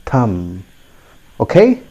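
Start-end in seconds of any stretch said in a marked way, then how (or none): 0.0-0.6 "Thumb" with silent b; low, soft "Thumb" ending on m; simple model "Thumb", no b; correct pronunciation. Shown as a demonstration. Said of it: "Keep it simple".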